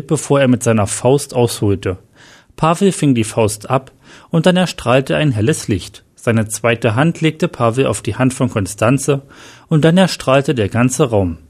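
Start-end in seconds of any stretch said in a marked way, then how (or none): none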